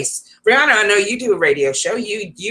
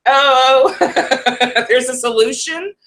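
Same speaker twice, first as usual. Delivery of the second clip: laughing; 5 semitones higher, slower than usual. Real, not acted. Laughs at the song's answer.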